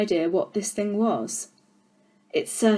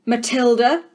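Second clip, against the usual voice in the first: loud, higher bark of a name; 4 semitones higher, 7 dB louder than usual. Acted. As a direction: snap the name out like a sergeant. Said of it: "she barked".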